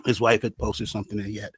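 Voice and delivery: low, heavy-hearted recollection; subdued grief. Real, not acted. Recalls friend's sudden death.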